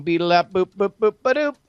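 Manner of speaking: high sung beeps for dialing; mouth dial tones. Pretend. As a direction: beep out a phone dial tune. Dialing phone sounds.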